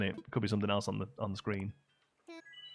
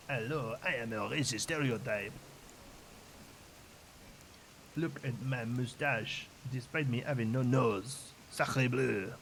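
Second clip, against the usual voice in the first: hammed-up cartoon Frenchman; slow, over-the-top French accent; exaggerated French accent; slower than usual. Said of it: "my crazy racist French accent".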